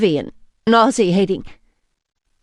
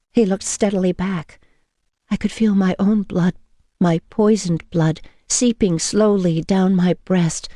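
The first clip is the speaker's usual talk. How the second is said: usual speaking voice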